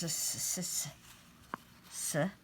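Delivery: hissed s sounds, soft; teacher sounding out "s" for children; phonics sound. Shown as a demonstration. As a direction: say "s" as a bare hiss. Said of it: "So we need s".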